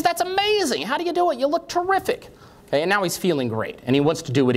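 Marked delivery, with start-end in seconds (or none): 0.0-2.3 put-on high, gushing young clerk voice; squeaky amazed cashier. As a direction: gush like an impressed shop clerk.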